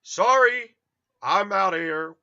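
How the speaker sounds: loud, high mock yelp; retold hasty retreat. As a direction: call it out loudly while backing off. Retold hasty retreat after her outburst.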